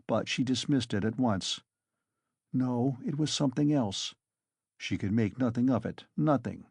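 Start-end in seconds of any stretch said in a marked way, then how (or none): none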